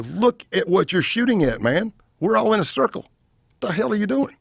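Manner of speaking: high, loud scolding; instructor scolding. Retold or imitated. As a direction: bark at him like a range instructor. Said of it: "the instructor in me comes out".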